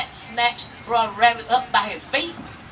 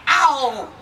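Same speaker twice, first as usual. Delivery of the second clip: sharp higher yelp of pain; 5 semitones higher, 6 dB louder than usual. Acted. Bear bumping his head in the snare, sharp pained ow. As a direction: yelp as the head hits the wood.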